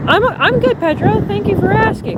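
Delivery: sweet high voice to Pedro. Pretend.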